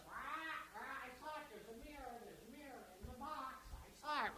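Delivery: small, high parrot squawk. Acted. Captain's parrot giving away the box trick, soft high squawk. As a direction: tattle softly in a squawky parrot voice. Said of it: "the captain's parrot goes".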